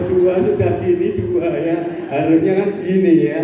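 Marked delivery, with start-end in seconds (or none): none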